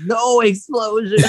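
high, drawn-out cry through laughter; slow, high-pitched comic protest; mock inner protest. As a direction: wail it in mock alarm, laughing. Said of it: "I'm just thinking in my head".

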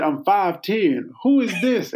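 high-pitched retold puzzled reply; playfully perplexed quote; mock puzzlement. Retelling his puzzled text reply.

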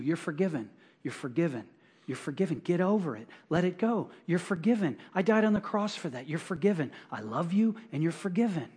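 soft, tender reassurance from God; God's gentle voice. Acted. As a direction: say it quietly, like forgiving a child. Voicing God's gentle message.

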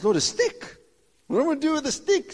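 high, fast, disbelieving protest; incredulous Moses. Acted. Moses protesting to the Lord about a stick, high and fast. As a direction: squeak out the doubt, quickly.

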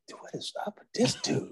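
hushed, whispered disbelief; mock-shocked whisper; stunned aside. Stunned aside at big man crying.

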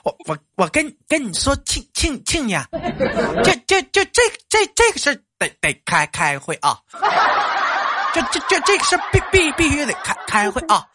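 high stammering village cadre insisting; high stuttering Liu Neng; Liu Neng impression. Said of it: "你猜这是谁".

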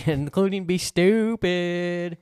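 high, loud, slow silly voice; goofy put-on voice. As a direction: drawl it out like a goof. Continues goofy voice.

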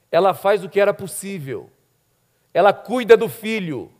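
normal talking voice

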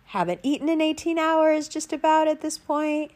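ordinary talk, nothing marked

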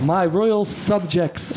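high, slow royal proclamation with a lisp; lisping king. Acted. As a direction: address the court as a mock lisping monarch. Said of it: "forced everyone to speak with a lisp".

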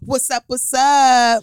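slow drawn-out announcer intro; intro attempt. S1 retrying the show's opening line, slow announcer voice. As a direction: stretch the greeting like a radio host. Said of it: "attempts the podcast intro".

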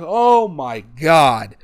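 very slow, drawn-out disbelief; stunned reaction. Retold or imitated. Reliving shock as Candice turns on Gargano, stunned. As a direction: stretch each word in shock. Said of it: "And I'm like".

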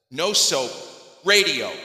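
loud, emphatic joke punchline; punchline delivery. Acted. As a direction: land the punchline big and loud, word by word.

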